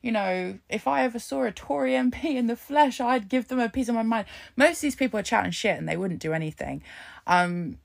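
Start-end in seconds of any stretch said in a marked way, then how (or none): 0.0-4.2 higher, hammed-up mock bravado; mocking tough talkers. Mocking people who brag they'd confront a Tory MP, higher mock bravado. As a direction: parody the big talk of would-be revolutionaries. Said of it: "talking about".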